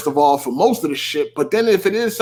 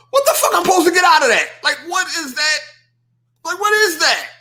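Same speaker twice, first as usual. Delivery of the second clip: exasperated outburst; 8 semitones higher, faster than usual. Real, not acted. Exasperated rant about the tweet.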